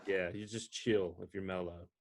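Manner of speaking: ordinary talk, nothing marked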